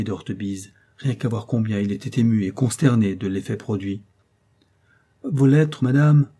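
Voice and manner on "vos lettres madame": feigned hesitation, higher and louder; hesitant. A narrator's reading. The doctor answers the countess with feigned hesitation. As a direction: fake a nervous hesitation. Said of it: "avec une visible hésitation".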